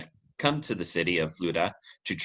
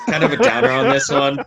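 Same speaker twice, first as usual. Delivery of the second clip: much higher, louder mock whine; high squeaky voice; 9 dB louder than usual. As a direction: squeak it out in falsetto. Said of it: "No voice".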